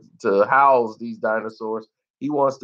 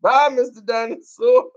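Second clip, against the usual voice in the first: mock farewell; 11 semitones higher, 3 dB louder than usual. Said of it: "I'll be like".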